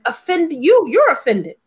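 ordinary talk, nothing marked

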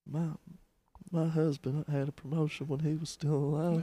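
a hint of a mournful woman dampening good news; gloomy coworker. Coworker answering Sarah's promotion news, lightly mock-gloomy. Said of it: "She's like".

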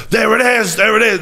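high, excited "there it is"; gleeful discovery cry; excited exclaim. Retold or imitated. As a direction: shout it out like you found the proof. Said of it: "he was like".

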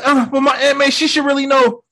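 imitating a kid's high-pitched plea; high, insistent imitation; high mock pleading. Peter anxiously adds exceptions.

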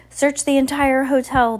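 high, loud order; commanding. A narrator's reading. Villainous man orders the crowd to search, loud command.

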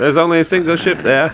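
high, loud British officer voice; hammed-up British accent; cocky British general. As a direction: call it out like a cocky British naval commander. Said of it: "some fucking cocky British general".

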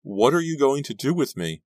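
high, loud, desperate question; frightened cry. Acted. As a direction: cry out in terror. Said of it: "she cried".

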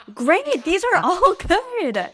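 high, loud, slow gushing; excited praise. Real, not acted. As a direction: cheer him on loudly. Enthusiastic praise.

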